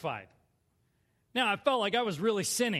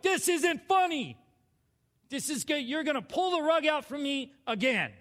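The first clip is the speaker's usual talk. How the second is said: loud, high re-enacted outrage; mad; 7 semitones higher, 5 dB louder than usual. Back home, fearing the rug pulled out, mad. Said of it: "I'm mad. I'm still mad".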